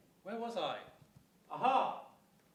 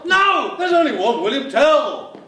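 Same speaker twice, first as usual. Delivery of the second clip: comic shout; 3 semitones higher, 6 dB louder, slower than usual. Acted. Clown insisting to partner, loud shout. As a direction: shout it out at the audience.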